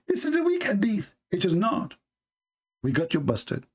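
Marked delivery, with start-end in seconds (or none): 0.1-1.1 high, mocking critic's voice; mock the critics' claim in a high squeak; mocking the opponents. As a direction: pitch it way up, scoffing like the other side. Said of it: "what you will say to me".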